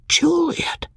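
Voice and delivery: whispered name, high and hollow with despair; a lost, wondering whisper of her name. A narrator's reading. Abandoned husband repeating her name, despairing whisper. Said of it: "a dumb, despairing wonder".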